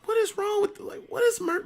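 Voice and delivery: high, incredulous what-the-hell; baffled. Retold or imitated. Hearing screams from his room, high baffled disbelief. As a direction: sound totally thrown by the noise. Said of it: "I'm like".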